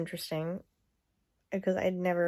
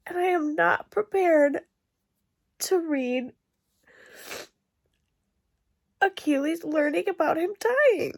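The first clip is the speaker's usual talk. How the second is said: crying; 5 semitones higher than usual. Real, not acted. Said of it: "me crying".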